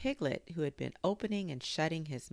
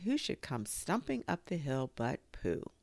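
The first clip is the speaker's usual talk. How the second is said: ordinary delivery, nothing marked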